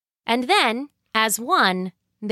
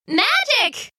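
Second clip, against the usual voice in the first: high, bright exclaimed "Magic!"; two wives answering together in delight; delighted reply; 12 semitones higher than usual. Acted. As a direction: announce it with glee. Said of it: "replied the two Missus Hacktacks together".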